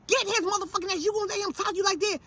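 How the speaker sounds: high-pitched, rapid goading; egging on. Retold or imitated. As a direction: urge your son into the fight. Acts out egging son on.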